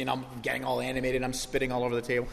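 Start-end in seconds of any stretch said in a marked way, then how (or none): none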